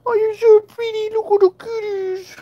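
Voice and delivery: high, sing-song pet voice; baby talk to cats. Acted. Owner cooing to her cats, high baby talk. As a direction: coo at the cat like a baby.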